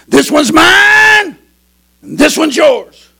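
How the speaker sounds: high, drawn-out shout; looming loud call. Acted. Boys overheard by frightened grandpa, slow shouted call. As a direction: stretch mine into a long loud cry. Counting heard as heart pounds.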